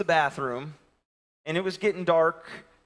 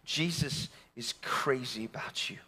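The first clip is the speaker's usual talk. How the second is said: soft and sincere; 4 semitones lower, 9 dB quieter than usual. Real, not acted. Repeats softly.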